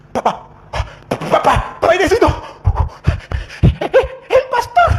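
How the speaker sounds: hammed-up high squeaky panic, gasping between words; comic imitation of breathless fright; trembling, out of breath. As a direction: stammer it out like someone who fled in terror. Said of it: "llegó corriendo temblando".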